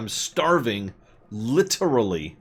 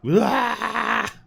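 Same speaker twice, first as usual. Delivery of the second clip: high, loud yelp; startled cry; 8 dB louder than usual. Real, not acted. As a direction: yelp in sudden fright. Sudden game event.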